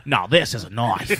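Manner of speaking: low-pitched mock Australian accent; Crocodile Hunter. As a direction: drop low into a put-on Aussie accent. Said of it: "expecting Crocodile Hunter to pop out".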